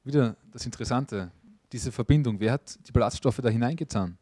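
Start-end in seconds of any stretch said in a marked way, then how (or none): none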